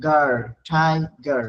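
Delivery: গার then ঠাইগার blended very slowly; slow blending. Shown as a demonstration. Teacher slowly blending syllables for students. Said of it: "syllable division".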